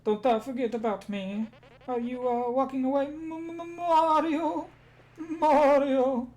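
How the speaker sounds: high, loud cartoon wail with a stammer; Luigi's voice. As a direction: cry out up high, stutter, then wobble the name. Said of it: "Especially Luigi's. He, he has a very specific way".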